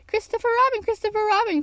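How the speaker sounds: high, urgent repeated call; crying out. A narrator's reading. Piglet appealing to Christopher Robin, high cry. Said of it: "cried Piglet".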